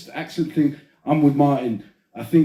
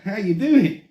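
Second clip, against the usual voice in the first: high, loud Aussie greeting; Australian accent; 6 semitones higher, 5 dB louder, slower than usual. Acted. Mates trade accents, he tosses off an Aussie hello, Australian accent. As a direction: greet him like a blokey Australian. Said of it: "Australian accent".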